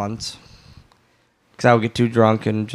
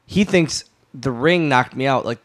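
ordinary talk

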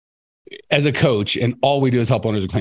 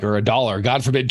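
ordinary talk, nothing marked

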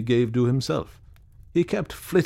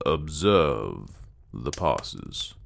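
deliberate, drawn-out question, lower in pitch; slowly; 6 semitones lower, much slower than usual. A narrator's reading. Sanderson questioning Clayton about the passes, slowly. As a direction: let the question sink down slowly. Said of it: "said Sanderson slowly".

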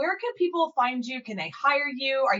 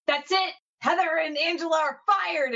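higher, playful yelled firing; mock yell; 5 semitones higher than usual. Speaker yelling from the front of the room, mock yell. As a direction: call it out across the room like a boss. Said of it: "like, yell out".

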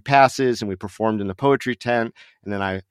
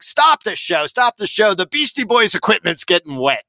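much higher, urgent mock yell; stage manager stopping the show in a panic; frantic shout; 10 semitones higher than usual.

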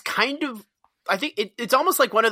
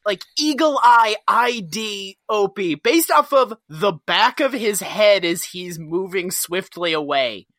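ordinary talk

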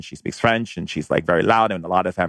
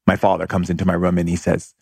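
ordinary talk

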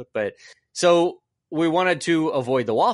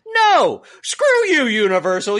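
ranting shout; 3 semitones higher than usual. Acted. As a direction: spit it back at the company. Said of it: "why we do a rant".

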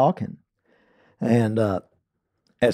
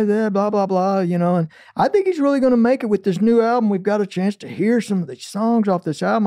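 radio DJ; 7 semitones higher than usual. Retold or imitated. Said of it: "He's going".

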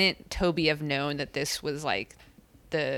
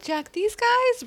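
high, slow, disapproving; drawn-out scoff at Jack; mock judgmental; slower than usual. Retold judgmental remark.

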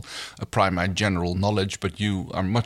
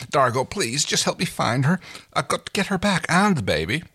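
higher, urgent pleading; desperate appeal, breaking at the end; voice cracking plea; 8 semitones higher than usual. A narrator's reading. Crichton begging Dargo to help find her, desperate. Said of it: "His voice cracked on the last word".

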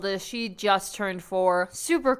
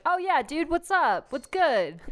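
lightly hammed-up dude greeting, pitched up; chummy higher-pitched hello; casual buddy attitude; 7 semitones higher than usual. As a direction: greet a kid like one of your pals. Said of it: "I have that attitude towards them".

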